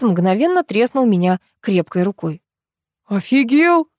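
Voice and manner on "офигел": high, outraged outburst; raised-pitch shout of outrage; angry yell. A narrator's reading. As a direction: yell it in indignation. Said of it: "заорал Олег".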